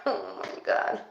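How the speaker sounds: shaken. Real, not acted. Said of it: "I'm literally shaking right now".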